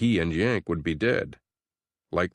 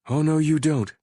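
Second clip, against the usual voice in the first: defiant female voice; 9 semitones higher, 5 dB louder than usual. Acted. Jen stops them from approaching.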